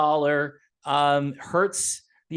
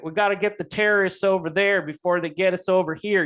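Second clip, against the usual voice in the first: a light Texas drawl, George Bush style; lightly mock a Texan president's drawl; mild imitated Texas drawl of a war-hawk president; 2 semitones higher, 3 dB louder than usual. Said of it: "I can hear that little drawl Texas accent".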